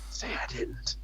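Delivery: very soft whispered prompt; secret whisper. Pretend. Secretly prompts the stream audience.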